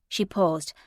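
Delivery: ordinary delivery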